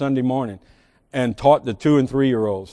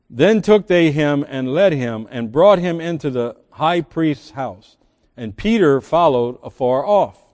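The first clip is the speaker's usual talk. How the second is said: steady Bible reading; scripture reading; 4 semitones higher, 4 dB louder than usual. Acted. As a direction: read the story of Peter aloud. Reads Luke 22 aloud.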